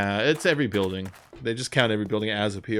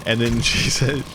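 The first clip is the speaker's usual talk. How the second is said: ordinary talk, nothing marked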